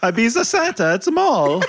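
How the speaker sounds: lightly hammed Italian accent; a hint of Italian accent; mock Italian accent. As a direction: join in the Italian teasing. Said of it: "sounds like he's Italian".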